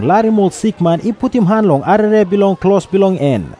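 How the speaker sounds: ordinary talk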